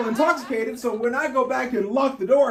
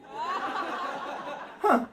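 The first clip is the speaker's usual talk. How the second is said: normal talking voice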